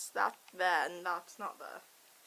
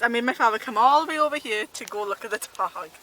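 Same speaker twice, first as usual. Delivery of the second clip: ordinary delivery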